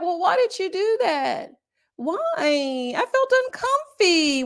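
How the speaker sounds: high mock whine, slow at the end; imitating Chelsea whining that she felt uncomfy. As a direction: stretch out the sulky complaint. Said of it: "she whining".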